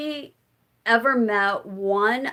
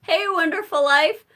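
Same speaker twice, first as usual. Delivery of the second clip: higher, louder and fast with glee; excited retelling; 5 semitones higher, 5 dB louder than usual. Retold or imitated. Telling the poet at the book signing, gleeful and fast. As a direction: rush it out, thrilled. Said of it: "I was so excited".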